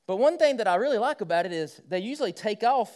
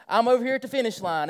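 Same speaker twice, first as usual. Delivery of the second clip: usual speaking voice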